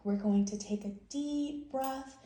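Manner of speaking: plain reading voice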